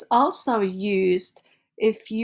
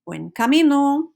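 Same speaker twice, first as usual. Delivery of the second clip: louder, higher Buen camino called out warmly; cheerful wish; 6 semitones higher, 7 dB louder than usual. Shown as a demonstration. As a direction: wish a passing pilgrim a good way. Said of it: "say it with expression".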